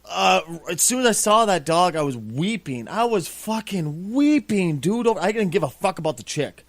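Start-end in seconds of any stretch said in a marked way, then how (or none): none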